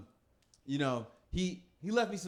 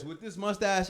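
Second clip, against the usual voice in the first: normal talking voice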